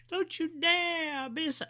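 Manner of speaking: high, drawn-out hype-man catchphrase; Big E. Retold or imitated. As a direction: attempt a wrestler's signature cry, slow and way up high. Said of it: "let him do the big".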